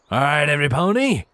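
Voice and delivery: cheeky call. A narrator's reading. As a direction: call the line with a cheeky grin. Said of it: "he said loudly with a cheeky grin".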